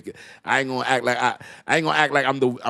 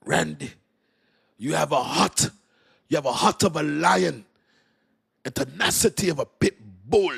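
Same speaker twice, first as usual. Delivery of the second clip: put-on Jamaican accent; 6 semitones higher than usual. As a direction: imitate a Jamaican reverend bestowing praise. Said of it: "it's so cool with Jamaican accent".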